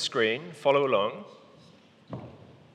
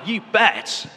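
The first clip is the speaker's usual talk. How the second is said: loud, higher, emphatic agreement; really loud; 5 semitones higher, 5 dB louder than usual. Shown as a demonstration. Leader models the daughter's reply for a volunteer, loudly. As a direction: belt out a keen, punchy reply. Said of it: "you say really loudly".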